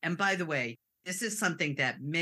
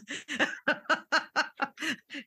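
laughing; 11 semitones higher than usual. Real, not acted. Laughing at her trick of asking what others feel. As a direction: burst out laughing at yourself.

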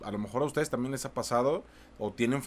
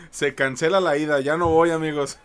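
mock loud refusal; 3 semitones higher, 8 dB louder than usual. Host jokingly cancels visit.

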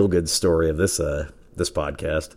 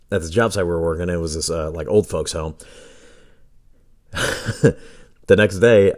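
normal talking voice

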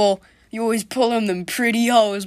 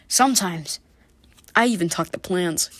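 normal talking voice

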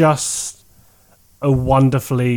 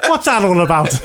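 put-on Irish accent; 6 semitones higher than usual. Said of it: "I shouldn't be doing the Irish accent".

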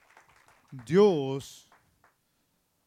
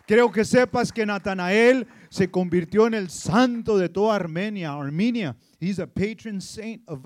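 loud, higher, shouted sermon climax; fervent preaching; 4 semitones higher, 15 dB louder than usual. Real, not acted. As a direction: preach it at full force. Preaching at full force. (predicación a todo pulmón)

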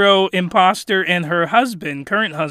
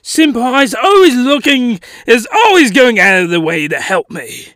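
eager, badly faked British voice; hammed-up British houseguest; terrible British accent; 4 semitones higher than usual. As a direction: gush in a bad British accent. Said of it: "terrible British accent".

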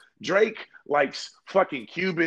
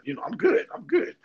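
giddy delight; 10 semitones higher than usual. Retold or imitated. Delighted reaction to free dances.

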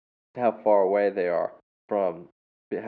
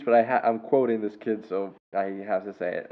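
normal talking voice

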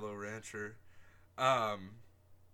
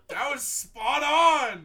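high, loud laughing praise; 12 semitones higher, 14 dB louder, slower than usual. Real, not acted. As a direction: say it while cracking up.

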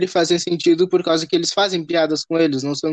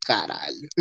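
low quoting voice; 9 semitones lower, 9 dB quieter than usual. Retold or imitated. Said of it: "referência".

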